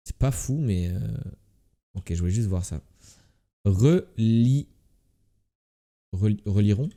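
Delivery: ordinary delivery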